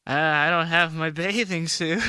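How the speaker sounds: put-on slow, deadpan awkward teen; Napoleon Dynamite. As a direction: mumble it slowly, socially awkward. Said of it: "your Napoleon Dynamite impression".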